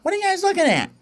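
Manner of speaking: high sassy chick voice; cheeky little bird. Acted. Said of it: "Smalls looks up and goes".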